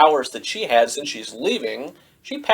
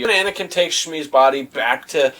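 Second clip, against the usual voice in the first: usual speaking voice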